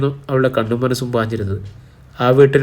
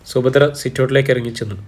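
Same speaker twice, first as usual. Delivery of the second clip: ordinary delivery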